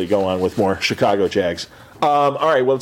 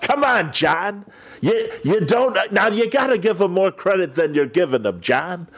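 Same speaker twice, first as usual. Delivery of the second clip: voicing Steve Stone; 7 semitones higher than usual. Impersonating Steve Stone to John.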